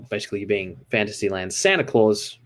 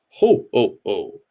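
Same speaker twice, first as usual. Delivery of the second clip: a stiff attempt at a Santa laugh; rehearsed jolly laugh; 4 dB louder, slower than usual. A narrator's reading. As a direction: Death practising his jolly ho ho ho. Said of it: "I've got the laugh working really well now".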